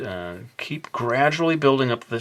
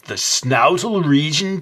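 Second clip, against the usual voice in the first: higher, slow incredulous echo of the word "snoutal"; mock skeptic; 6 semitones higher, 4 dB louder, slower than usual. Mocking his own made-up word as a disbelieving friend, incredulous. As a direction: play a doubting friend repeating the word slowly.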